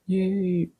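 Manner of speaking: mock yay. Sarcastic cheer at one Greek actor.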